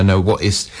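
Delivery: ordinary talk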